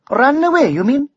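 high scornful Welsh question; Welsh dragon. A narrator's reading. Dragon scorns the knight, high Welsh lilt.

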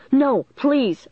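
high, urgent plea; crying out. A narrator's reading. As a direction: cry out to stop him. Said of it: "I cried".

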